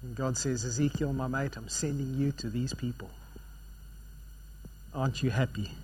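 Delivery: ordinary talk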